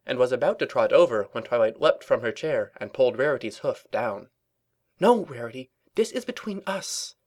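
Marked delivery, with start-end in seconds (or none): 5.0-7.1 higher-pitched, pressing plea for discretion; urgent hiss. A narrator's reading. Twilight stops Rarity's greeting, urgent and hushed. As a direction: urgently hush a friend. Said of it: "she hissed urgently".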